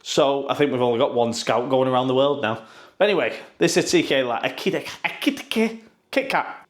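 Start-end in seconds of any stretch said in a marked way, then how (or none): 4.4-6.7 Ekitike garbled into Kit Kat, pitched up; tongue-tied Ekitike collapsing into Kit Kat; fumbling the name. Shown as a demonstration. Vlogger stumbling over the striker's name again. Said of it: "It's like I'm having a stroke".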